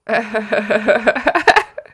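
high laugh. Real, not acted. Laughing after the joke.

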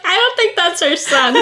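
high, incredulous, holding back a laugh; amused disbelief. Retold or imitated. Watching a movie with husband, amused disbelief. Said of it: "And I'm like".

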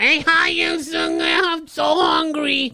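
high, slow, whiny comic nerd caricature; Jerry Lewis type. Acted. Comic mocking the image of a meek man, high nerdy squeal. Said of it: "Jerry Lewis, uh, type voice".

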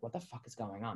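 hushed, low aside to oneself; inner thought. Retold or imitated. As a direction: drop it right down, like a puzzled private thought. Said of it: "in my head".

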